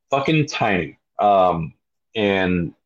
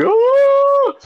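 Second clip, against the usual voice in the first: much higher, louder startled cry; startled yelp; 5 dB louder than usual. Retold or imitated. Dangling off the ship, high startled yelp. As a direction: yelp as you dangle over the sea. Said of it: "looking down at the water like".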